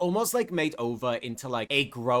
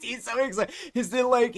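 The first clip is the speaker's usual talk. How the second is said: squeaky, higher-pitched repeat of the gush; even higher gush; 10 semitones higher than usual. A narrator's reading. Narrator squealing to viewers over excited Argus. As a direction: push it up into a delighted squeal.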